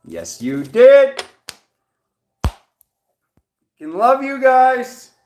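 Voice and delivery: high, loud and slow delight; joyful cheer. Real, not acted. Reader cheering viewers who passed the test, slow and joyful. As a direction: stretch it out with joy.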